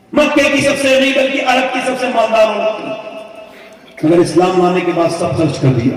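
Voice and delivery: high, loud impassioned sermon voice; fiery pulpit preaching; preaching at full force. Acted. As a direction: pour out the story to the crowd. Impassioned retelling of Khadija's hunger.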